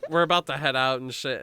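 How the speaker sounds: ordinary talk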